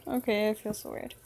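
usual speaking voice

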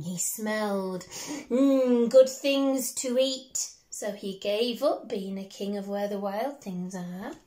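ordinary delivery, nothing marked

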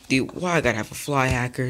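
ordinary talk, nothing marked

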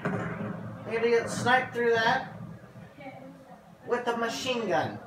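high, slow incredulous rise. Real, not acted. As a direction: stretch it out in disbelief. Repeats louder, incredulous.

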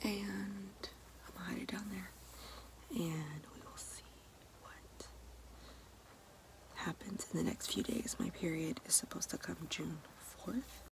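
soft secret whisper; hushed whisper hiding the pregnancy test. Real, not acted. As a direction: whisper as if someone might overhear.

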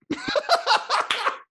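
high, loud laughing fit; high burst of laughter. Real, not acted. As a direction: crack up remembering it.

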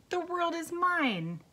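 high, slow triumphant cry; exclaiming. A narrator's reading. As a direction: proclaim it grandly, stretching the words. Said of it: "he exclaimed".

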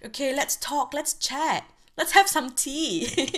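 high, bright-loud welcome to a visiting feeling; excited greeting. Retold or imitated. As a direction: greet the emotion like an old friend dropping by, pitch way up. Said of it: "I am excited. I'm like".

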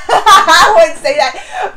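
laughing it out slowly. Real, not acted. Fan laughing at her own jab at the king, laughing. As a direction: giggle through the words.

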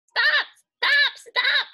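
very high, loud squeaky cries; a mongoose calling from its rock; chittering mongoose. Acted. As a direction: squeal it like a small animal. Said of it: "chittered the mongoose".

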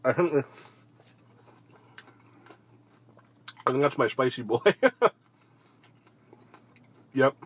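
chewing while talking; speaking between bites; mouth full. Real, not acted. Seen 2: Bites and chews wing throughout; mouth full, cheeks puffed while speaking.

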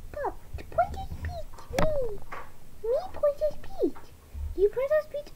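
lightly voiced toddler, high and whispery; baby voice. Baby doll insisting she is Princess Peach, lightly high. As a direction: babble it like a tiny tot.

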